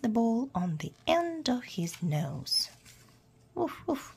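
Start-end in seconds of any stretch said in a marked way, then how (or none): 3.5-4.1 high-pitched imitated woofs; two high, playful mock barks; puppy bark. A narrator's reading. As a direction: do a small dog's yap. Narrator voices the puppy barking.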